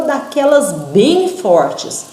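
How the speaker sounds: daquelas bem fortes said slow and weighty; strong emphasis. Shown as a demonstration. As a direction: lean hard on bem fortes. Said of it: "quer dizer que é bem forte mesmo". (ênfase forte em bem fortes)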